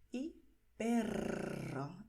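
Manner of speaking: "perro" slow with the RR trilled long; rolled R. Shown as a demonstration. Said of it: "volvemos a tener la vibración". ("perro" con RR muy vibrante)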